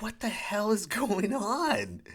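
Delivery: high, slow disbelief; mock outrage; incredulous. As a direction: stretch it out, can't believe it. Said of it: "I was like".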